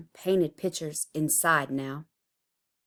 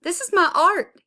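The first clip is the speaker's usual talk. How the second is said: high, loud anguished cry; cried out; 12 semitones higher, 8 dB louder than usual. A narrator's reading. Tyree cries out as bulldozers crush his art. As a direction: cry out as they smash it. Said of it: "he cried".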